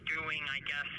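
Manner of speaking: normal talking voice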